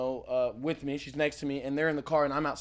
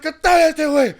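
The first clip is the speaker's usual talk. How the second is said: high, loud yelled words; retold shout; 10 semitones higher, 9 dB louder, slower than usual. Telling the hosts how he shoved the man, a shouted line. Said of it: "I was like".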